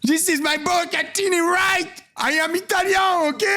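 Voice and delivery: high, shouted mock Italian-American accent; mock Italian chef. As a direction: ham up the chef's boast. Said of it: "J'ai perdu mon accent italien".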